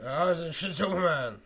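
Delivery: loud, slow growling caricature; Russell Crowe. Acted. Co-host showing how the actor talks, loud growly impression. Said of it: "that's how Russell Crowe talks".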